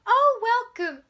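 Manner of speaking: loud, high, over-the-top greeting; mock gushing welcome. Mocking the Bureau greeting the arrivals, loud and high. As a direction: gush like a too-eager host.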